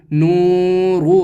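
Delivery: slow "نورو" with the و of "نو" held long, short "رو"; teacher blends "نورو" slowly; joined word, drawn out. Shown as a demonstration. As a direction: stretch "نو", clip "رو". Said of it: "نون کھنچے گا".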